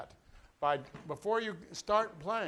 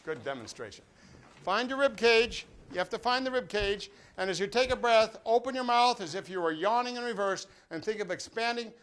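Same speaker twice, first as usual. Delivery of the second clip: calling to the class; 4 semitones higher, 8 dB louder than usual. Real, not acted. Teacher calls class to stand.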